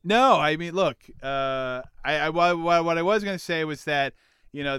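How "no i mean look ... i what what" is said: ordinary delivery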